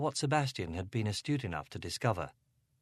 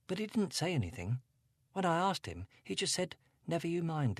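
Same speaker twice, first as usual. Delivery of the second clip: put-on higher, upset young girl voice; Angela, a hint of upset teen; teenage girl; 7 semitones higher than usual. A narrator's reading. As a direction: voice her as a troubled teenage girl.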